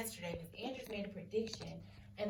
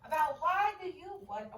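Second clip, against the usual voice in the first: ordinary talk, nothing marked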